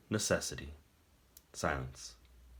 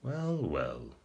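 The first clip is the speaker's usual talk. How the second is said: warm captor; 4 dB quieter than usual. A narrator's reading. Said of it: "said a warm voice".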